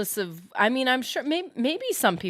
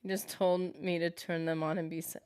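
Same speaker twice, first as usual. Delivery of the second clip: ordinary delivery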